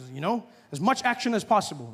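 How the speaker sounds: ordinary delivery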